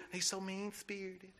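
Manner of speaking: soft, whiny mock complaint; softly mocking the critics. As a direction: imitate the sniping church member under your breath. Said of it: "What I'm saying is we".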